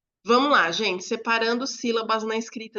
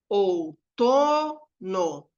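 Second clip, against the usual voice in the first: Outono with a long, louder TO, syllables apart; stressed syllable demo; 4 dB louder, much slower than usual. Shown as a demonstration. Teacher finding the tonic syllable, dragging out TO in Outono. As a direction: stretch and punch the TO in Outono. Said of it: "identificar a sílaba tônica".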